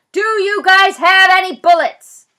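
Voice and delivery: slow, high, loud yelled question. Acted. Zach yelling a question at the armed police. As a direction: holler it over to the cops. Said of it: "I yell".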